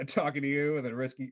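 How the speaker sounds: ordinary talk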